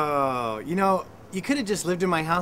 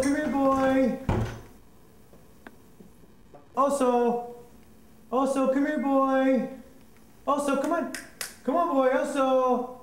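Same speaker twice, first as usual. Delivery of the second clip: calling a dog; 7 semitones higher than usual. Real, not acted.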